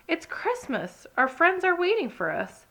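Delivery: put-on bright, eager young rabbit. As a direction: urge him on excitedly. Rachel urging Ted.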